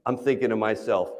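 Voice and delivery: ordinary talk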